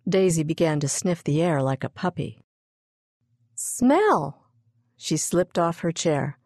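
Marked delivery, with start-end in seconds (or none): 3.5-4.5 put-on high, eager little-girl cry; excited child. Daisy sniffs the air like a puppy, excited child voice. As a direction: pitch it up with a child's sudden excitement.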